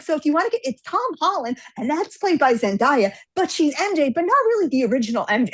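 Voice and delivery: high, run-on nerd explanation; acted comic-geek lecture; geeky rambling. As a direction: go on and on, correcting yourself. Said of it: "she starts going on".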